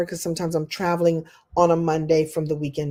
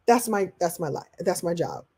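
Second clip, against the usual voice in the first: normal talking voice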